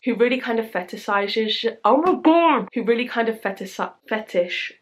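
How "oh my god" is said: loud, high cry of frustration; real frustrated yelp; exasperated outburst. Real, not acted. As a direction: burst out, fed up with tripping over a word. Frustrated at repeatedly fluffing a word.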